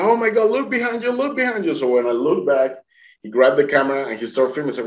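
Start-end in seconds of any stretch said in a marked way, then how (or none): 0.0-1.7 very high, urgent exclamation; acted excited alarm. Swimmer alerting boatman to the dolphin, high and excited. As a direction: cry out high to make him look.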